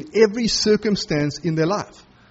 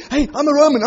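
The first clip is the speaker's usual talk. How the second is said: mock yell; 8 semitones higher, 5 dB louder, faster than usual. Imagined plea while dragged.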